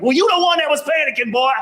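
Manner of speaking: high-pitched mock doctor retort; scolding doctor. Doctor to complaining patient, high-pitched scolding. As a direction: pitch it way up and tell him off.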